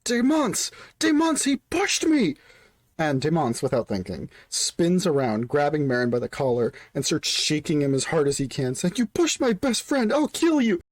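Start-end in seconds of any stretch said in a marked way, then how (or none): 0.0-2.4 high-pitched acted yell. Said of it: "someone from the bottom of this pit yell up". 9.0-10.8 high-pitched angry outburst; acted furious threat. Enraged friend shaking the accused.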